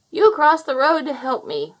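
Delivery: put-on a grateful old woman speaking up; old woman's voice.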